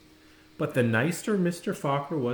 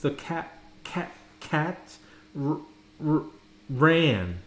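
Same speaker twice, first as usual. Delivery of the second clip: halting reading; slower than usual. A narrator's reading. Girl struggling to read aloud in class, halting. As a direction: stumble word by word like a struggling reader. Child stumbling over reading.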